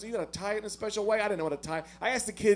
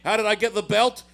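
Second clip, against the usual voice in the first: loud, hammed-up bad Irish accent; botched Irish brogue that slides towards pirate; terrible Irish accent; 3 semitones higher, 7 dB louder than usual. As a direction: do Conor McGregor in an awful Irish accent. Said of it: "the worst Irish accent you'll ever hear in your life".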